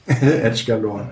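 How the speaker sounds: laughing through the words; amused, chuckling as he says it. Real, not acted. Repeating joke while laughing.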